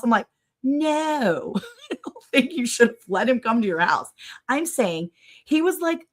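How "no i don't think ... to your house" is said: laughing retort. Retold or imitated.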